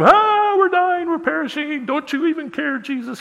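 high, frantic cry of panic; mock terrified plea, voice way up high; panicked disciples. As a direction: wail it like men about to drown.

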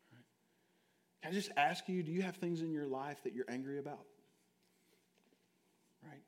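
ordinary talk, nothing marked

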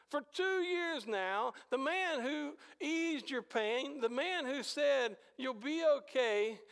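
a preacher's high, exasperated disbelief; indignant outburst. Real, not acted. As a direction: rail at the forgetful cupbearer in disbelief.

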